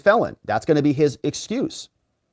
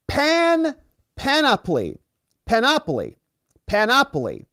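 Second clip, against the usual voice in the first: "panoply" stressed on the second syllable, pan-OP-oly; stress drill; 9 semitones higher, slower than usual. Shown as a demonstration. Correcting a word for viewers, "panoply" stressed pan-OP-oly. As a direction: hit OP high in "panoply", repeated slowly and separately. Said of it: "the emphasis".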